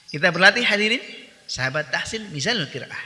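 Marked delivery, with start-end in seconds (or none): none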